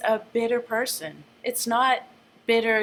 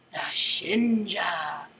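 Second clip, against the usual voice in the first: slow, hissing snake whisper; 3 semitones lower, much slower than usual. Acted. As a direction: breathe the name like a snake. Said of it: "he whispers like a snake".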